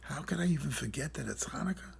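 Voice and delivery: whispered self-reproach; a hushed whisper of regret; said to himself. Retold or imitated. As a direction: whisper it to yourself in dismay. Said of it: "I said to myself".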